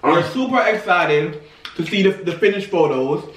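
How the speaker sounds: eating between words; talking while chewing. Real, not acted. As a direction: mouth partly full. Seen 0: Both twins hold and bite bread while talking; speaker unclear.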